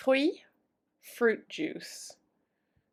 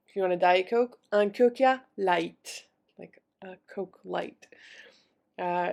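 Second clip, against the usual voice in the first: usual speaking voice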